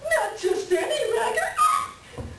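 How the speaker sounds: high and slow, laughing as it comes out; half-singing the name in giddy excitement. Acted. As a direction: savour the big reveal with delight. Showing off a prized record.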